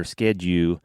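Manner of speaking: "Skedu" with no L, the U held and let ride out; Southern "Skedu". Shown as a demonstration. Explaining how older Alabama men say "Skedu", drawn out. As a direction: say "Skedu", drop the final sound and stretch the U. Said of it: "drop the L E and just hang on the U".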